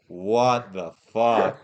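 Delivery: very slow, low-pitched disbelief; stunned disbelief. Retold or imitated. Friends alone in the car afterwards, stunned disbelief. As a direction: drag out each word, dumbfounded.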